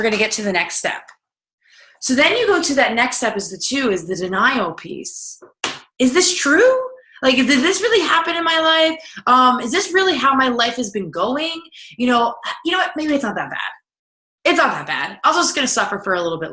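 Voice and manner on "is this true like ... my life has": high, incredulous self-questioning; doubting disbelief. Retold or imitated. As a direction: ask yourself in disbelief, pitched up. Self-doubting inner voice quoted.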